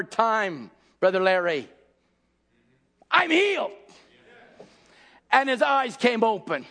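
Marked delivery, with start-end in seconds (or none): 3.1-3.7 triumphant declaration. Retold or imitated. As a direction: burst out with it. Final confession.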